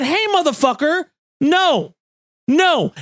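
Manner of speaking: wag a finger at the president; mock scolding court. The court telling Bush off, mild mock scolding. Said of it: "they said".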